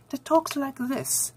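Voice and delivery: mock English accent. Shown as a demonstration. Reviewer mimics female characters' talk, mock English accent. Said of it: "has this English accent".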